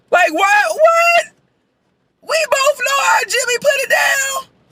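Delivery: very high, squealed disbelief; incredulous. Real, not acted. Viewer reacting to the messy friend crew, incredulous. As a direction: shoot the pitch up in astonishment.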